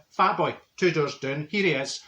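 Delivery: ordinary delivery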